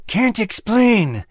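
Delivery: talking through clenched teeth; gritted teeth. Acted. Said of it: "through gritted teeth".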